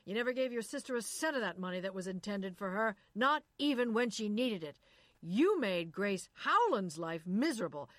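loud accusing tirade; furious shout. Acted. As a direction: hurl every accusation at her. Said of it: "shouted at her from there".

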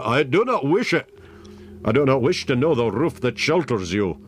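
high, vehement refusal; sharp interruption. A narrator's reading. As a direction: cut in and reject it outright. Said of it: "interrupted the other".

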